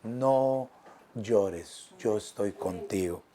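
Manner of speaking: hushed tender reassurance. Acted. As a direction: comfort a hurting child. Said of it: "a tu corazoncito y te dice".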